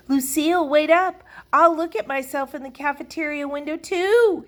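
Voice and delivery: high, loud eager calling; excited shout. A narrator's reading. Sheldon shouts after Lucille to wait, excited. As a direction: call after her, stretching the end. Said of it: "he began to shout".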